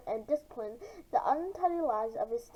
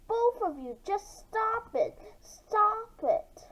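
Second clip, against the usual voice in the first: higher, slowed plea to stop; raised teacher plea; 7 semitones higher, much slower than usual. Acted. Teacher begging both students to stop fighting, raised. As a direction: beg them to stop, voice climbing.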